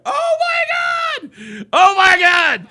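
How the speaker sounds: high, loud shrieked exclamation; hilarious disbelief. Real, not acted. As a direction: cry it out high, overcome with hilarity. Cracking up at video clip.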